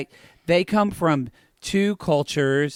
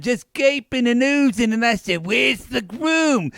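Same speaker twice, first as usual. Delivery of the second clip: Porn Granny; 4 semitones higher than usual. Acted. Porn granny at the bridal party, lewd higher voice. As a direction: ham up a randy old granny. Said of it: "I discovered this character".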